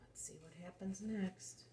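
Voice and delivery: hushed whisper, building suspense. Acted. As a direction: whisper it to draw the child in. Suspense while reading to a child.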